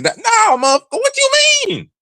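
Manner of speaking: high, fast outraged burst; high indignant outburst of disbelief. Retold or imitated. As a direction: explode in disbelief at the suggestion. Outraged at imagined reply.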